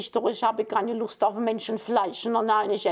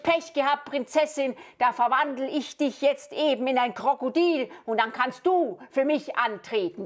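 normal talking voice

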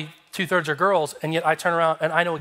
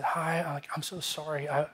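soft, consoling approach; acted tender apology to someone crying; gentle apology; 8 dB quieter than usual. Comforting the sobbing girl, retold.

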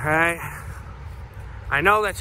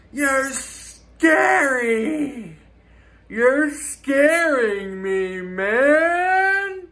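mock scared crying; 7 semitones higher, much slower than usual. Mock fearful breakdown at haters.

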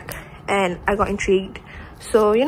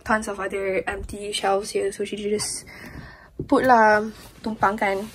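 ordinary talk, nothing marked

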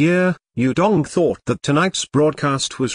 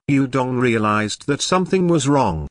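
usual speaking voice

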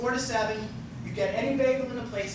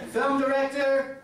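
announcer introduction; 5 semitones higher, 4 dB louder than usual. Real, not acted. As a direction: build up and call the name high. Host announces a guest.

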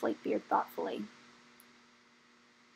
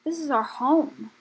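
higher, louder and slow, grieving; despairing; 4 semitones higher, 6 dB louder, slower than usual. A narrator's reading. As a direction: plead slowly in despair. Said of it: "cried one of the dragons in despair".

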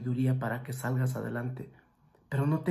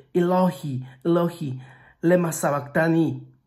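loud cry; 3 semitones higher, 6 dB louder than usual. Retold or imitated. Jesus on the cross crying out to God, loud anguished call. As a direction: cry it out like a dying man calling to God. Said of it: "clamó a gran voz diciendo".

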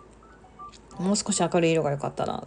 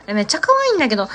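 quick delighted burst on 可愛い; excited gush; 6 semitones higher, 4 dB louder, much faster than usual. Real, not acted. Streamer delighted by the finished map, fast and excited. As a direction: rattle it off fast, thrilled. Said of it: "もうめっちゃ可愛いんだけど".

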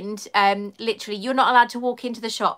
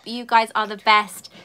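ordinary delivery

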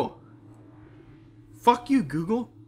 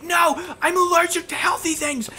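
high squealing shout, hammed up; mock outraged shriek; 14 semitones higher, 4 dB louder than usual. Friend retells a goofy fight, shrieking villain's line. Villain voiced in silly story.

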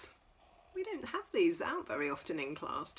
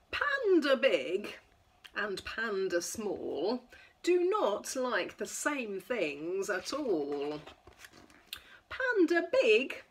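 lively sing-song picture-book reading; reading to children; 5 semitones higher, 4 dB louder, slower than usual. Acted. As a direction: read it bright and playful for toddlers. Reads picture book to toddlers.

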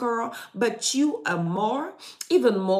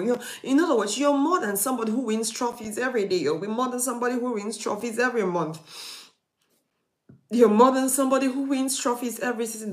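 building preaching; 4 semitones higher, faster than usual. Acted. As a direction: build each line toward the peak. Building list, sermon climax.

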